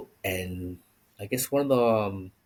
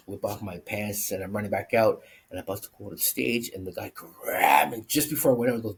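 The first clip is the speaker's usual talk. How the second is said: normal talking voice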